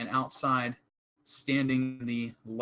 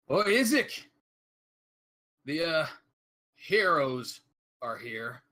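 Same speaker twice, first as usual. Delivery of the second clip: loud high call with a mocking slow "heroes"; guard calls the Baron's man, mocking the heroes; sarcastic guard call; 5 semitones higher, 5 dB louder than usual. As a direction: shout it through the door, sneering at the heroes. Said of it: "you can almost hear him air quoting the heroes part".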